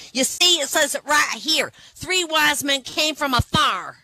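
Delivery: high, loud, triumphant voice; mock Southern church lady reading scripture; indignant lady. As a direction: jab the point home like a know-it-all. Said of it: "And she goes".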